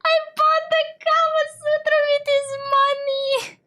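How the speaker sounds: very high squealing through real laughter; hysterical laughter. Real, not acted. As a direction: fight to get the words out while laughing. Laughing fit over her own choice.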